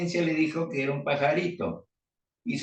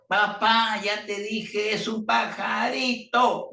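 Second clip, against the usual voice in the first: fed-up son; 9 semitones higher, slower than usual. Acted. Son shouting at his old father, slow and fed up. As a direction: drag it out, losing all patience.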